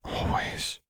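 a breathy, soft "Always"; soft whisper. A narrator's reading. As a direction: promise it in a whisper. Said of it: "Lux replied".

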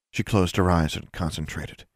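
ordinary delivery, nothing marked

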